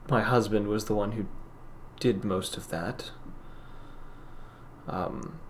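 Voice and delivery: lightly voiced sad shopkeeper, softly; softly, with a hint of sorrow, halting pauses; sorrowful shopkeeper, subdued. Game master plays sorrowful shopkeeper.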